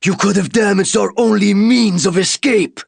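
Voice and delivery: high, loud alien voice, furious; angry alien. Acted. Alien warrior to the human who nearly wrecked the ship: loud, high, angry. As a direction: rage at the risk to their only way out. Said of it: "His mandibles clicked in anger".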